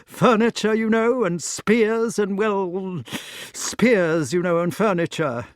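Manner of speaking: put-on high, loud, babbling old knight; a host bluffing about his guest's name; flustered Sir Ector. A narrator's reading. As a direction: ramble nervously to cover the lie.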